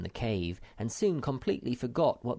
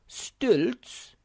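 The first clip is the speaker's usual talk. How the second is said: high, loud scoffing question; doubting Snork; 12 semitones higher, 6 dB louder than usual. Acted. Chairman scoffs at the stilts idea, loud.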